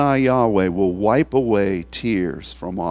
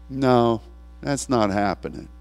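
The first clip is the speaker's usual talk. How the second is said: ordinary talk, nothing marked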